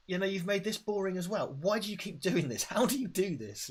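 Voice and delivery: mock exasperation, pitched high; exasperated. Scolding Stephen Summers over boring films, mock exasperated. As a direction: plead in disbelief with the culprit. Mock complaint addressed to the director.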